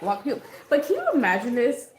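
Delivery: ordinary talk, nothing marked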